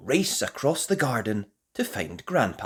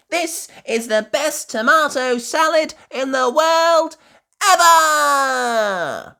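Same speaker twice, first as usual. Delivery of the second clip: much higher, loud joyful cheer; cheering Peppa; 14 dB louder than usual. A narrator's reading. Peppa cheers about the salad, loud and joyful. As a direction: cheer it out with glee. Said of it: "cheers Peppa".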